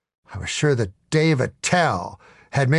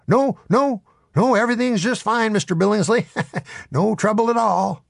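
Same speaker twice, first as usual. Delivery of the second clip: much higher, flustered reassurance; nervous placating; 10 semitones higher than usual. Acted. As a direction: placate the boss in a hurry, pitched way up. Devil placates the yelling barkeep.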